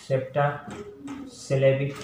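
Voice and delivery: septasyllabic broken into slow syllables; teacher spelling out septasyllabic; very slow syllable demo. Shown as a demonstration. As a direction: draw septasyllabic out, one syllable at a time. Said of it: "उसके बाद है septasyllabic words".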